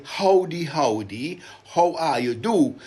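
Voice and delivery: "Howdy, howdy, how are you do?" said loud in island dialect; lilting island greeting; Vincentian dialect greeting. Shown as a demonstration. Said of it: "in my wonderful Vincentian dialect".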